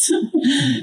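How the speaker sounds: a brief laugh to yourself; light chuckle. Real, not acted. Teacher to meditators after asking about craving, light chuckle.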